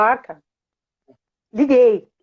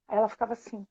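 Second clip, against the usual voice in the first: usual speaking voice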